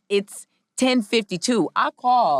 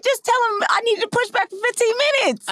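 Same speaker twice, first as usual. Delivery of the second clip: mock exasperation. Retold exasperated relay.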